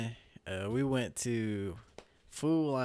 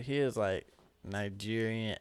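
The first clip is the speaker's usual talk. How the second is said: usual speaking voice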